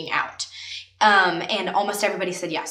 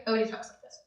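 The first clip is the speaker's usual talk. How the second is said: "Nobody talks like this" said much softer, half swallowed; quiet demo; 8 dB quieter, faster than usual. Shown as a demonstration. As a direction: drop "Nobody talks like this" to a soft murmur.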